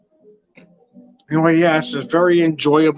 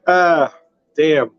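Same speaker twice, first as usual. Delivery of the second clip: normal talking voice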